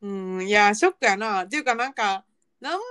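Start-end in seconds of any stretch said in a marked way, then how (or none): none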